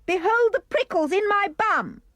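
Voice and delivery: high-pitched shout to mum. A narrator's reading. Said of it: "I shouted".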